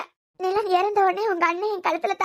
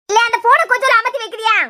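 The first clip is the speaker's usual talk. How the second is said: louder, annoyed request to put the phone away; irritated demand; 3 semitones higher, 8 dB louder than usual. Acted. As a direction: tell him off sharply. Mother irritated by phone filming.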